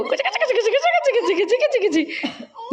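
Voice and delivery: high squeaky tickle sounds; playful tickling. Real, not acted. Mother tickling baby Shivi with high playful coos.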